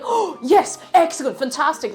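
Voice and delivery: high, gasping mock delight; imitated delight. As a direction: act out their thrilled reaction. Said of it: "they'd be delighted".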